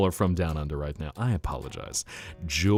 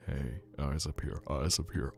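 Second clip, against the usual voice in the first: soft, low close-mic scolding; low teasing; 5 semitones lower, 9 dB quieter than usual. Pretend. Streamer tells gawking chat eyes up here, low teasing. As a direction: keep it quiet and low, right up close. Soft seductive bit continues.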